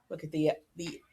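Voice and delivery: plain reading voice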